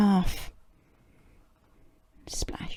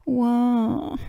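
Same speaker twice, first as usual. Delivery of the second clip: put-on a child's short wail "wah", raised up; cry; 4 semitones higher than usual. George crying over his broken toy, a short wail. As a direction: let out a little toddler's wail. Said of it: "cries George".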